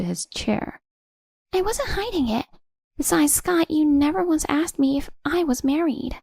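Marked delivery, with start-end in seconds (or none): 1.5-6.2 high defensive woman's voice. A narrator's reading. Nicole justifying herself.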